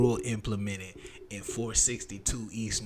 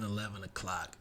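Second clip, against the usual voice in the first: ordinary delivery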